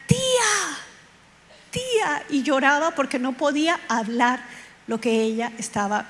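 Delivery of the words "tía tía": put-on high child's cry, fading on the second. Niece wakes at night calling her aunt, high crying call. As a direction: call out like a scared little girl. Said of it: "y empieza a gritar".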